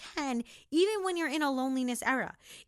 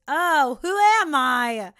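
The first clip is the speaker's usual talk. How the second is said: loud, delighted disbelief at herself; mock self-amazement; 2 semitones higher, 10 dB louder, slower than usual. Real, not acted. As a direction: marvel at your new self, loud. Self-amazed at waking early.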